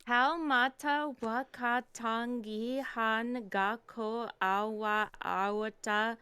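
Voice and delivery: slowly sounded out name; slow careful reading. Shown as a demonstration. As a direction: read the long name slowly, syllable by syllable. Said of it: "if you say it again".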